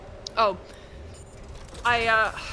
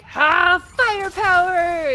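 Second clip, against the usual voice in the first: high, loud, heroic shout; kid superhero play; 9 semitones higher, 8 dB louder, slower than usual. Retold or imitated. A boy throwing a log on the fire, heroic shout. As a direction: announce it like a cartoon hero. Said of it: "he's just like".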